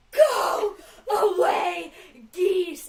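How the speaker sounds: "Go away, geese!" shouted high and very loud; loud child's shout. Retold or imitated. Sister shouting at geese in the park, loud yell. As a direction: belt it like a furious little kid.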